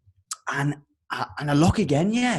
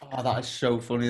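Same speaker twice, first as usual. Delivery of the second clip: usual speaking voice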